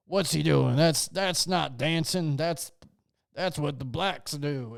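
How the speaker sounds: hammed-up disapproving onlooker; outraged onlookers. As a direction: imitate a scandalized man watching. Voices outraged guys watching [name].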